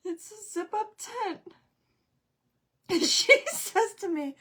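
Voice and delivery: high, loud, breaking into laughter mid-sentence; can barely get the story out for laughing; helpless laughing. Real, not acted. Mother telling about her daughter's mail-order sauna, helpless laughing. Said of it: "I don't know why I'm laughing".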